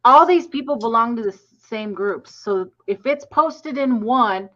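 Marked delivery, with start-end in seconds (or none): 0.0-0.2 laughing through the words; chuckling exasperation. Real, not acted. Laughing while complaining.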